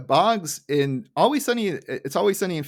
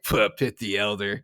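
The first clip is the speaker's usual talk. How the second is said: low offhand mock retort; 5 semitones lower than usual. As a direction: toss it off in a lower voice. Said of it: "Mo is just like".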